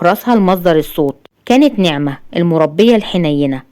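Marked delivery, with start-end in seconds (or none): none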